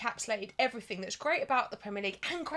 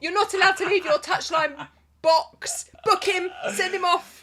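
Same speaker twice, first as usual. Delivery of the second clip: loud, higher-pitched mock tirade; re-enacted shouting; 6 semitones higher, 6 dB louder than usual. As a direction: holler like a fan demanding a card. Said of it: "I think I was actually shouting".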